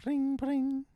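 high, squeaky mock ringtone; phone ring imitation. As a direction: chirp it like a ringing phone. Imitates phone ringing to start.

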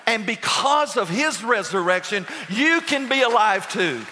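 full-voiced gospel preaching; preaching at full force. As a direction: preach it out to the congregation, building. Preaching climax on the resurrection.

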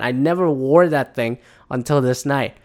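usual speaking voice

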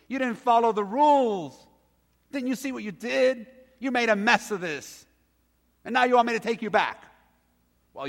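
high, loud scolding rant; mock scolding father berating his son; nagging father. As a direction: lay into the kid like a fed-up father. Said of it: "That's not what the father said".

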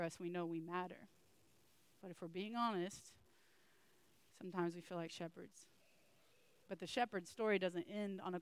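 low, soft, unhurried prayer voice. Acted. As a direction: pray quietly for the congregation. Closing prayer.

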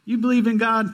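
high, loud astonished question; an astonished, rising "You believe in God?"; surprised disbelief. Retold or imitated. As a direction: sound amazed that he believes. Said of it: "He's like".

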